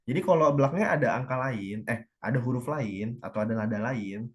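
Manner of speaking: ordinary talk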